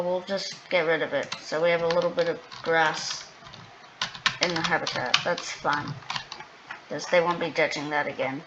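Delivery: ordinary delivery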